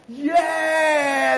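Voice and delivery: high, loud cheering yell; crowd cheer. Retold or imitated. Imitating the crowd cheering when blue wins. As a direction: cheer like the winning half. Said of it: "crowd goes".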